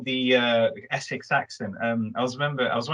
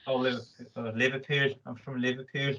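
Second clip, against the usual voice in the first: put-on Liverpool accent; 5 dB quieter than usual. Host playing along with the accent lesson, Liverpool accent. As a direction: try out a Liverpool accent lightly. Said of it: "a Liverpudlian accent".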